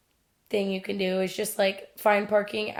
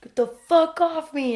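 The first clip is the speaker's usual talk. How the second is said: mock shout; 7 semitones higher, 3 dB louder than usual. Drunk boyfriend shouting.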